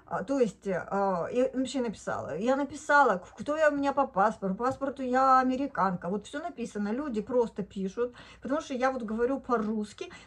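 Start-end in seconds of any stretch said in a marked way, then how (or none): none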